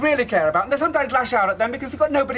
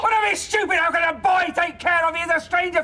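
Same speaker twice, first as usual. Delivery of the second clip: high, shouted scorn; angry shout; 4 semitones higher than usual. Acted. Father shouting at daughter about her boyfriend, scornful shout. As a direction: shout down the idea.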